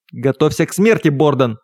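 plain reading voice

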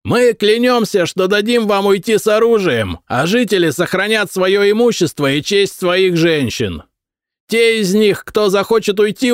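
high proclaiming yell; high herald's cry of promises. A narrator's reading. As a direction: keep bellowing the terms up at the wall. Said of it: "заорал всадник".

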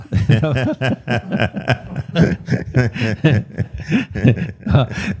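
risada solta e demorada; gargalhada longa. Real, not acted. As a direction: riso contido que explode. Ri do recado ao Piero. (gargalhada sincera)

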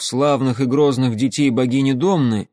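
ordinary delivery, nothing marked

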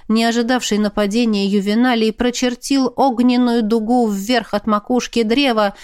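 ordinary delivery, nothing marked

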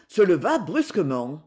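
ordinary delivery, nothing marked

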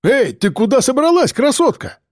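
high barked taunt; barking. A narrator's reading. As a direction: bark it at her as she backs away. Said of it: "гаркнул Рыжий".

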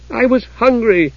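high-pitched defiant retort. A narrator's reading. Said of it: "she added defiantly".

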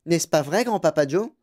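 high, put-on old woman's voice; animated grandma, lifted pitch; eager grandmother. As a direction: chime in eagerly, seeking agreement. Reader voices grandmother Joséphine.

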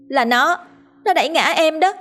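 put-on high, whiny child's voice, accusing; a small girl pointing and blaming through tears; crying little girl tattling. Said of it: "mà đáp". (giọng bé gái mếu máo mách tội)

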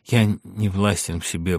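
plain reading voice

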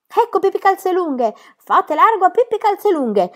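high, loud call across the quay; crowd shouting. Acted. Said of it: "si gridò da ogni parte".

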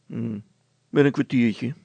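reluctant grumble; grumbling. A narrator's reading. Brilstra stalls his wife, grumbling. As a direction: put her off gruffly. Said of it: "bromde Brilstra".

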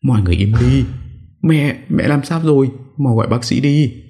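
high-pitched, acted alarm; flustered panic. A narrator's reading. Daughter-in-law calling for a doctor as mother faints, flustered. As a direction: hush everyone and call for help in a high voice. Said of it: "Bà luống cuống".

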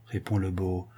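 usual speaking voice

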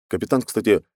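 plain reading voice

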